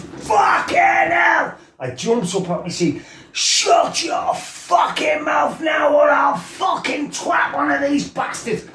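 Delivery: furious shout. Retold or imitated. Lad losing his temper, screaming at the girl. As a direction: explode with rage, screaming threats. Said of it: "my mate does a better impression of me than that".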